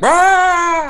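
high, loud imitated roar; mock monster roar. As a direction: roar out the demon's yell. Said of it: "starts hollering at her".

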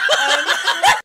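shrieking laugh; high laugh. Real, not acted. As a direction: squeal with laughter. Laughing hard.

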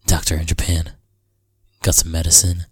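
ordinary talk

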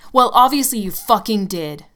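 loud, furious accusation; angry yell. Acted. Tim yelling accusations at his girlfriend. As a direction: spit it back at her. Tim yells back in the fight.

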